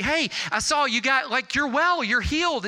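high-pitched, elated retelling; excited phone call. Retold or imitated. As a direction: burst out with the good news to a friend.

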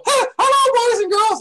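high, loud cartoon ant greeting; squeaky ant voice. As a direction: greet kids as a giant ant. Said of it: "I would sit there and go".

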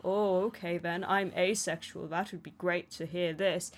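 normal talking voice

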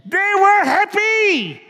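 high, slow, bright and joyful; happy. Acted. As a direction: lift it up with joy. Fable riders find precious stones.

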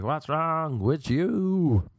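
slow, stretched-out heckle; mock audience reaction. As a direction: drag it out as a baffled imagined audience. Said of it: "they'd just be like".

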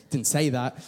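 ordinary delivery

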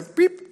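high squeaky piep like a button; playful mock beep. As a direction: chirp a quick electronic beep. Imitates a switch beep.

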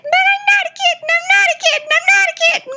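very high, loud repeated protest; furious tiny cat yelling; indignant squeal. Pretend. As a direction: shriek it, stressing not each time. Fluffy protests being kitten.

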